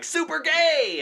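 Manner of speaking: high, bright mock squeal of approval; hammed-up enthusiastic fan; gushing fan. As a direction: gush it like an excited fan. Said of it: "they're like".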